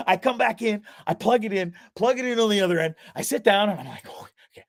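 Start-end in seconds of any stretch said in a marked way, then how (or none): 4.1-4.6 breathy unvoiced whisper of relief; hushed private sigh of okay. Private relief muttered to himself.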